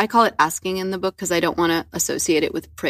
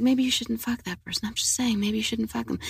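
very soft, higher-pitched gentle warning; quiet inner voice; 4 semitones higher, 7 dB quieter than usual. Acted. Guest voices the voice she used to hear, quiet and gentle. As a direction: murmur a mild, tentative suggestion. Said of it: "I used to hear that voice".

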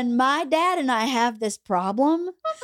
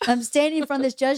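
normal talking voice

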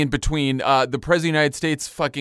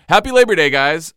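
sarcastic cheer; 3 semitones higher, 8 dB louder than usual. Pretend. Sarcastic sign-off after grim list.

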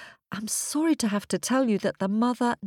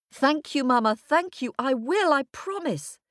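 put-on higher, eager little-girl voice; youngest daughter; 7 semitones higher than usual. A narrator's reading. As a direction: gush thanks like a grateful child. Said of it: "said the youngest daughter".

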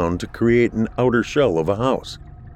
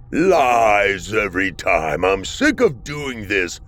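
slow bitter creature; slower than usual. Acted. Furious creature before yelling.

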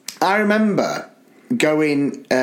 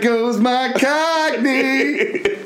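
slow mock lament; 8 semitones higher, much slower than usual. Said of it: "I was just like".

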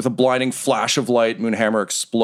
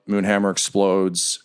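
ordinary delivery

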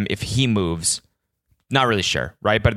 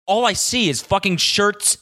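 normal talking voice

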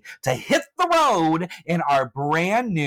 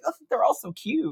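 mock gushing; 12 semitones higher than usual. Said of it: "I'm like".

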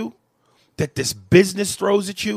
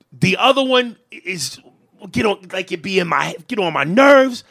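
ordinary talk

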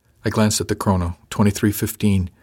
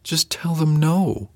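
slow, higher, mildly forceful; a bit forceful; 8 semitones higher, slower than usual. A narrator's reading. Officer telling the bullied crew member to refuse, mildly forceful. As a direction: press each word a bit, drawn out. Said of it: "I said a bit forcefully".

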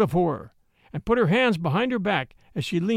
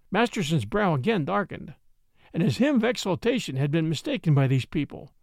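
plain reading voice

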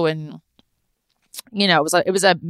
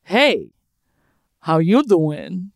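slow, slick Joey pickup line; Joey impression; slower than usual. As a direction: lean in and flirt like Joey. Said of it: "I looked at him and I went".

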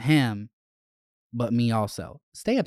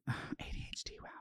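breathy whispered aside to herself; 18 dB quieter, faster than usual. Real, not acted. Host whispering an aside to her listeners.

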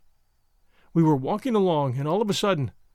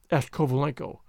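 ordinary delivery

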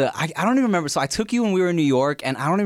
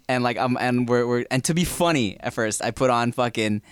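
ordinary delivery

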